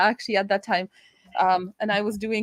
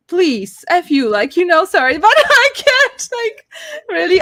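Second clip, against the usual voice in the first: louder, higher exasperated cry; exasperated outburst; 9 semitones higher, 9 dB louder than usual. Retold or imitated. Told her mom wants to pioneer, a loud exasperated outburst. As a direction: blow up in disbelief, half laughing.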